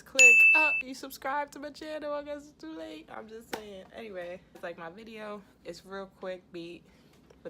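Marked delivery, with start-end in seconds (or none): 0.0-0.4 sharp spoken click noise; loud click sound. Retold or imitated. Imitating a mouse click. 0.5-3.1 mock surprised viewer. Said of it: "you'd be like".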